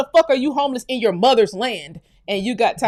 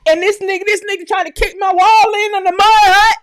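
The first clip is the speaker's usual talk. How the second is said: high, loud, indignant yell; outraged shout; 9 semitones higher, 6 dB louder than usual. Real, not acted. Imagining a man kicking her mud hut wall in, outraged shout. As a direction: scream your outrage at the intruder.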